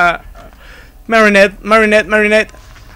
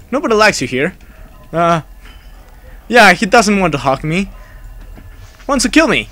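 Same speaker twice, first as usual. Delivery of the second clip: ordinary talk, nothing marked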